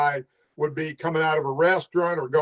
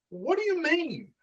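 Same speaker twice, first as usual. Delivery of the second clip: high-pitched, softer incredulous question; baffled question; 12 semitones higher, 5 dB quieter than usual. Retold or imitated. Officer questions self-appointed traffic helper, baffled. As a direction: act puzzled, pitch way up.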